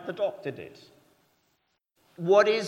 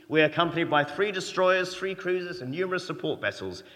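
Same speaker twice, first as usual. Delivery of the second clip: louder, pompous rising bluster; mock-blustering naval commander listing his fleet; flustered American captain; 4 semitones higher, 6 dB louder than usual. US carrier captain tries to intimidate the other ship, loud pompous bluster. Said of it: "Americans getting a bit flustered here now".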